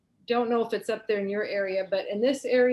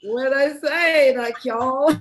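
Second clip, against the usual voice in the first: louder, higher puzzled question; mock bewilderment; 3 semitones higher, 7 dB louder, slower than usual. Retelling her reply to a New Zealand class, mock bewildered. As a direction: ask it loudly, as if baffled by the request. Retells being asked to repeat herself.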